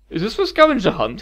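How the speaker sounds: excited count, hoping for a game; getting excited. A narrator's reading. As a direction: perk up with sudden excitement. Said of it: "he asked, getting excited again".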